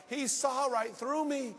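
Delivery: high, loud, slow astonishment; amazed exclamation. Acted. Preacher voices what the woman felt, loudly amazed. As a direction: proclaim it in drawn-out wonder.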